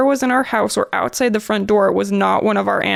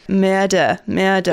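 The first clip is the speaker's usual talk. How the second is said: put-on Australian accent; slower than usual. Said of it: "Oh, that was pretty good".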